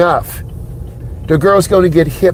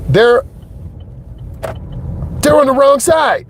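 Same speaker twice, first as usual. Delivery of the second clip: much higher, shocked alarm at the other drivers; startled outburst; 8 semitones higher than usual. Real, not acted. Driving instructor spotting cars on the wrong side, startled. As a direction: blurt it out, caught off guard by the cars.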